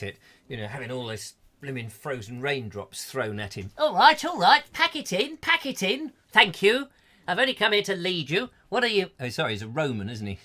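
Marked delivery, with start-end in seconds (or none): 3.8-9.1 high-pitched exasperated protest; indignant Caesar. Pretend. Caesar telling off people throwing hail, high indignant voice. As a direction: play a put-upon leader being pelted.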